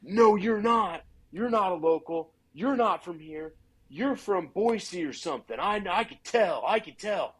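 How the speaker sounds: a local hunter accusing an outsider; angry accusation. Retold or imitated. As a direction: refuse to believe him, sneering. Angry stranger accuses him of lying.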